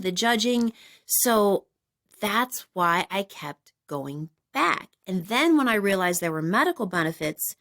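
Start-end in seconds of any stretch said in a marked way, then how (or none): none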